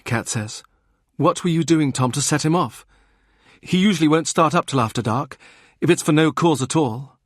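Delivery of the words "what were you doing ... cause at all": higher sharp sister's voice, questioning; Kat voiced. Kat questions Tom about the fight, higher voice. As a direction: raise the voice for Kat, pressing Tom.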